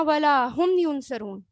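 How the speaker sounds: slow, ہم weighted in ولا ہم ینصرون; stress on ہم. Shown as a demonstration. Teacher repeats the verse for class, slow and stressed. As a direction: say it slowly, leaning on ہم. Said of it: "جب اس طرح کا sentence آتا ہے نا".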